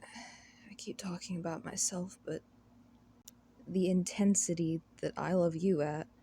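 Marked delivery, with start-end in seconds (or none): 0.0-2.4 whispered, sighing aside; whispering. Real, not acted. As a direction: mutter it under a sigh. Embarrassed self-reproach.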